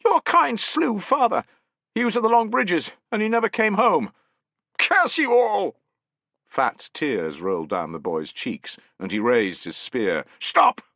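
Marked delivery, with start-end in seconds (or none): 0.1-5.8 put-on grieving boy. A narrator's reading. 10.4-10.8 loud, very high shout. A narrator's reading. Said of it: "Piso shouted".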